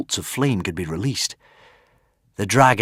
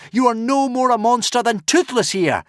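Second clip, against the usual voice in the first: cried out. Acted. Hiccup to the dragon, crying out. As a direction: cry it out to him.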